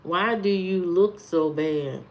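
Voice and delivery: usual speaking voice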